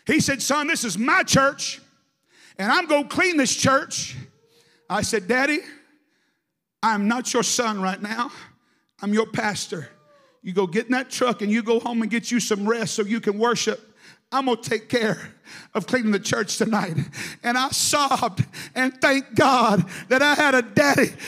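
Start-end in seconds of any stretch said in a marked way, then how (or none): 0.4-4.2 put-on stubborn old dad insisting on cleaning the church; stubborn insistence. Stubborn father insists, acted. 5.3-17.0 louder, commanding pastor; firm command. Retold or imitated. Pastor orders father home, acted.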